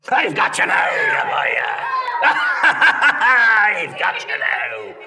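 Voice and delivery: loud, high booming shout; a spooky jump-scare yell with a cackle; scary shout. Acted. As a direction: leap out and roar it to startle them. Sudden monster fright line.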